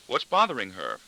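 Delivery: usual speaking voice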